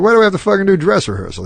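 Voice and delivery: fast, high-pitched mock complaint; exasperated, rushed protest; retold griping. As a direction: imitate a comic balking at rehearsal. Retelling comic's complaint about rehearsal.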